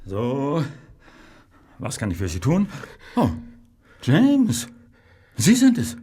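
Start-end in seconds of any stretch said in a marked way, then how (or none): 3.2-6.0 sudden higher, louder recognition; startled "oh, it's you"; surprised greeting. Acted. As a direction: caught off guard by James's arrival. Pharmacist surprised by visitor.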